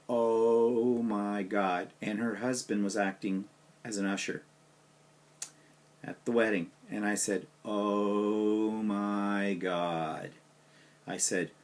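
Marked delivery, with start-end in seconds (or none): 0.1-1.9 very slow, drawn-out oh my God; stunned dismay. Retold or imitated. Learning the woman is the matron of honor, slow stunned dismay. Realizing he insulted bride's friend. 7.6-10.3 stretched out, half-sung oh my God; stunned dismay. Retold or imitated. Hearing her husband is an usher, a drawn-out sung groan of dismay.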